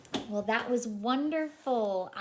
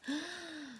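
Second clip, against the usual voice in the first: soft mock gasp; a quiet storyteller gasp; surprised gasp; 6 semitones higher, 8 dB quieter than usual. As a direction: gasp for the kids. Reveal in picture book.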